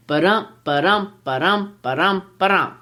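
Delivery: "Ba-dum" with weak "Ba", strong "dum"; iambic beat. Shown as a demonstration. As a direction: punch the second syllable of each "Ba-dum". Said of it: "it sounds like this".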